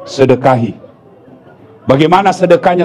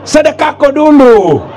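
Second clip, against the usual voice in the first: mock wife calling out; 8 semitones higher than usual. Wife calling husband in sermon joke.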